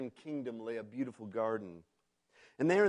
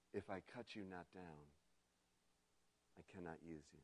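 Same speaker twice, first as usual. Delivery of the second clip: much softer, heavy and grave; graver voice; 6 semitones lower, 19 dB quieter, faster than usual. A narrator's reading. The master explains to bamboo, softer and graver. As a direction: lower it to a grave hush. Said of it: "the master's voice grew graver still".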